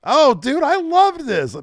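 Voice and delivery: high, loud enthusiasm; delighted gush. Real, not acted. Fan gushing about a favourite promo, high and loud. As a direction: burst out with real delight.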